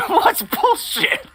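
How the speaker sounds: usual speaking voice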